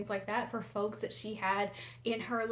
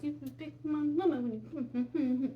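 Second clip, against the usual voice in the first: higher, childish mock sing-song; put-on juvenile voice, higher pitched; juvenile teasing; 5 semitones higher than usual. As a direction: goof around like a silly schoolkid. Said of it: "I just went straight juvenile there".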